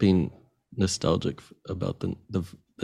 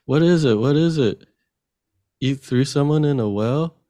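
talking to a dog; 7 semitones higher, 5 dB louder than usual. Retold or imitated. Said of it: "then he's like".

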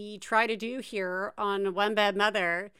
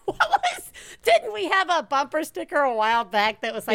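laughing; 5 semitones higher, 4 dB louder, faster than usual. Real, not acted. Laughing while recalling sticker.

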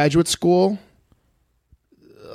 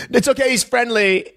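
higher breezy reassurance; mock dog owner; 9 semitones higher than usual. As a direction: toss it off lightly, pitched up. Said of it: "they'll go".

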